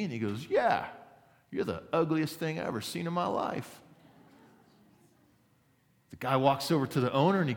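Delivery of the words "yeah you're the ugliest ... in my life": high-pitched cheeky parrot; raised parrot voice delivering an insult. Acted. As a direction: taunt someone as the parrot in a joke. Parrot insults customer in joke.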